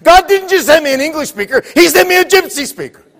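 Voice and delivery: high, loud preacher's climax; triumphant preaching. Real, not acted. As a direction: exclaim the wonder to the congregation.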